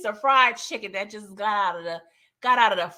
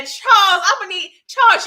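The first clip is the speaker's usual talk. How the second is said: louder, higher calling his name; excited call-out; 8 semitones higher, 10 dB louder than usual. Real, not acted. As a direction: hype him up across the room.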